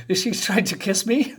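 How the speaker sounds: reading the line through a laugh. Acted. Villain wondering aloud about a kiss, laughing. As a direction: chuckle while asking it.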